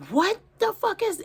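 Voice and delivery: high shocked exclamation; retold startled cry; shocked outburst. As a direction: blurt it out in shock. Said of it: "I was like".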